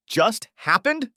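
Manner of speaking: high, slow mocking repeat; incredulous scorn. Husband mocking his wife's excuse, incredulous. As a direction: throw her words back at her. Husband throws her words back.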